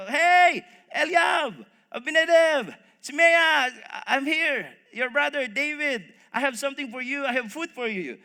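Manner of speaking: loud, high shout to brothers far off; calling out. Acted. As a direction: shout the names, then ease down.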